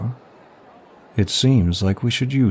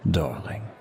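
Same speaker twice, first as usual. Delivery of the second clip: darling breathed much softer, falling at the end; 2 semitones lower, 8 dB quieter than usual. Acted. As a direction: let darling drop to a hush. Flirting with the stranger.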